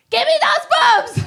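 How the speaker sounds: very high mock-baby squeal; husband's demand. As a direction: squeal it like a greedy grown man. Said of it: "he's like".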